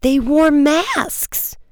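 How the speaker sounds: very high, drawn-out protest; indignant insistence. A narrator's reading. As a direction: squeal it out slowly, exasperated. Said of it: "growing swollen".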